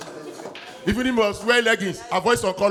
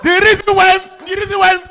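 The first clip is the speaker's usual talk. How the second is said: emphatic shout; 10 semitones higher, 9 dB louder than usual. Real, not acted. Repeated emphatic opener.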